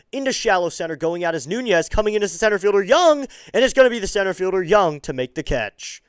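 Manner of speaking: excited baseball commentator; excited play-by-play. Real, not acted. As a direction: call the fly ball as it happens, urgency rising.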